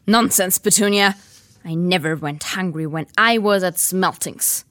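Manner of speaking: hurried bluster. Acted. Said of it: "said Uncle Vernon hurriedly".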